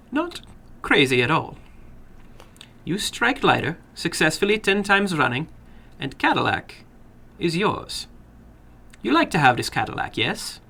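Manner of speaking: put-on sly older gentleman with an accent; probing the boy, a touch sly; foreign little man. Narrator voices the little man.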